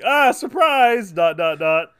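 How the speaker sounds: high, loud drawn-out mock surprise; Seth Rogen. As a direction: stretch out a big goofy "surprise". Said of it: "that was so Seth Rogen of you".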